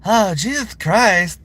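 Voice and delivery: high, slow drawn-out exasperated voice; Mister Slave voice. Shown as a demonstration. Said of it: "I can do Mister Slave".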